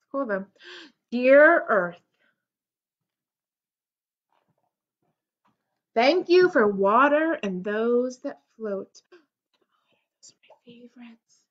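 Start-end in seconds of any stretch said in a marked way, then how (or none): 1.1-9.0 storybook read-aloud; reading to children. Acted. Reads picture book aloud. 9.1-11.4 soft whispered aside; whispering. Real, not acted. Whispered aside during reading.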